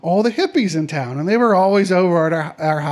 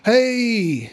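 higher-pitched Texas drawl greeting; 6 semitones higher than usual. Acted. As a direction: draw the greeting out like a Texan. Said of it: "He had this Texas drawl".

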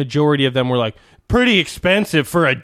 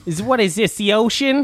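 put-on higher, tentative Jersey accent; Jersey accent attempt; 6 semitones higher than usual. Copying the co-host's Jersey accent, raised pitch. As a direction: echo it in a mock Jersey accent. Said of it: "could do a Jersey accent".

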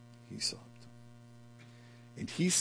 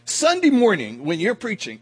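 usual speaking voice

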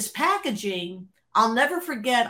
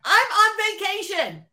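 higher, incredulous, mock-exasperated retort; exasperated disbelief; 10 semitones higher than usual. Retelling how she snapped back at the printer on vacation, mock exasperated. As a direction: act out the fed-up reply.

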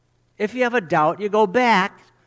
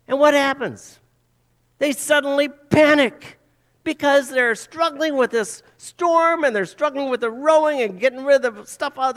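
dramatic; 6 semitones higher, 3 dB louder than usual. Real, not acted. Dramatic storm retelling.

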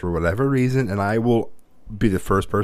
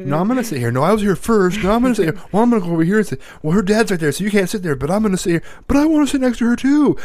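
put-on high, louder squabbling kids; bickering boys; 10 semitones higher, 4 dB louder, faster than usual. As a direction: play little boys fighting over a seat. Boys bickering over seat.

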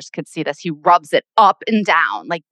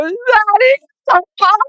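much higher, breaking with tears; crying out; 5 dB louder than usual. Retold or imitated. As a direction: sob it out to dad on the phone. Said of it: "I go".

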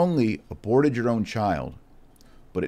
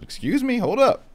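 much higher, offended disbelief; indignant surprise; 4 dB louder than usual. Retold or imitated. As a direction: jump up in pitch, taken aback. Retold objection to an accusation.

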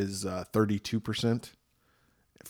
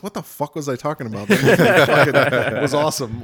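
excited self-quote; 4 semitones higher, 8 dB louder than usual. Retold or imitated. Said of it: "I'm like".

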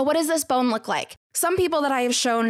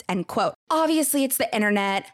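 normal talking voice

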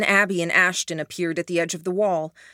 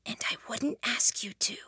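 soft unvoiced whisper; 5 semitones higher, 9 dB quieter than usual. A narrator's reading. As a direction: breathe it with no voice at all. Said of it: "Helen whispered".

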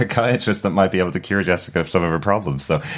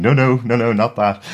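urgent objection; 5 semitones higher, 4 dB louder than usual. Retold or imitated. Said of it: "Trish is in the background going".

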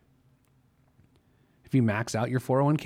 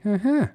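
high, louder approving uh-huh; 10 semitones higher, 5 dB louder than usual. Shown as a demonstration. Host turning a good savings tier into a sound, approving. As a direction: chirp a pleased uh-huh. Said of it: "express that via sound, it'd be like".

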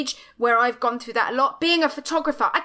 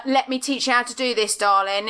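ordinary talk

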